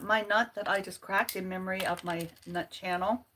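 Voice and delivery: ordinary talk, nothing marked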